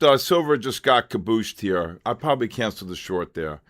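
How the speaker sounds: ordinary delivery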